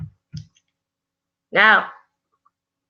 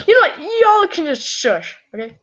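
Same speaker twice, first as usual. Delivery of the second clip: mock exasperation; 8 semitones higher than usual. Streamer shushes the teasing subscribers, mock exasperation. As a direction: squeal it in mock annoyance.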